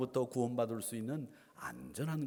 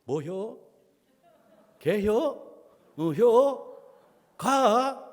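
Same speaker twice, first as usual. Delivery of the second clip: loud, high, terse Chungcheong exchanges; Chungcheong intonation; 7 semitones higher, 8 dB louder, much slower than usual. Shown as a demonstration. Pastor demonstrates short exchanges, exaggerated Chungcheong accent. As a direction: swing each short word with Chungcheong intonation. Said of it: "인토네이션이".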